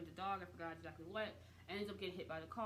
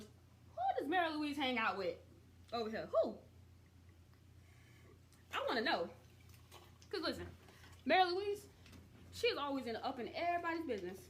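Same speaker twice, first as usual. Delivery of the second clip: higher and louder, mock outrage at her nosiness; worked-up rant; 7 semitones higher, 4 dB louder, faster than usual. Real, not acted. Viewer ranting to the audience about Mary Louise, loud and indignant. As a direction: call her out, fired up and loud.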